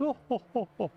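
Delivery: high booming laugh; raucous belly laugh. Acted. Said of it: "with a raucous belly laugh".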